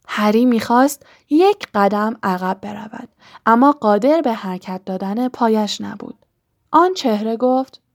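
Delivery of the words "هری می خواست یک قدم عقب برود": ordinary delivery, nothing marked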